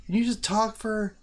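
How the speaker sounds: high, loud wife imitation; wife mocking his long streams. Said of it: "She's like".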